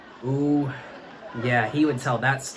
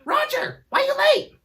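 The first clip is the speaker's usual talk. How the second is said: high squeaky professor voice; professor's question; 5 dB louder than usual. Retold or imitated. Professor quizzing late Roger, shrill high voice. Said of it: "his professor would be like".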